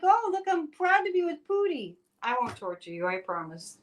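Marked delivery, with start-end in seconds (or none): 0.0-1.9 high sappy voice of a smitten fan; lightly voiced lovestruck politician; mock smitten. Host imitating a leader beside Putin, mock smitten. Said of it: "He's like".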